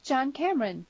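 put-on high-pitched girl's voice; bright-pitched daughter answering her father. A narrator's reading. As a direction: announce him in a high girlish voice. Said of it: "said the girl".

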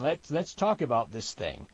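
ordinary delivery

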